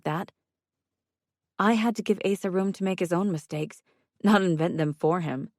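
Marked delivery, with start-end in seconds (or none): none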